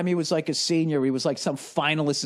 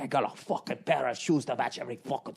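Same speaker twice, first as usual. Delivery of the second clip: Conor McGregor; 5 semitones lower, 6 dB quieter than usual. Retold or imitated. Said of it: "nobody makes their money like Conor McGregor".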